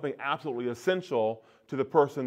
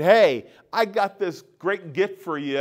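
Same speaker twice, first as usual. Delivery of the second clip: higher, louder, drawn-out teasing offer; mock God offering; 5 semitones higher, 6 dB louder than usual. Speaker mimicking God teasing with a gift, mock offering. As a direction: dangle a gift, slow and loud. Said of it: "it's not like God saying".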